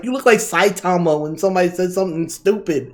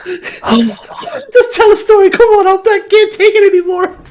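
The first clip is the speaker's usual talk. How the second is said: begging between helpless laughs; laugh-crying plea; 11 semitones higher, 3 dB louder than usual. Real, not acted. Friend begs the storyteller to finish, laugh-crying.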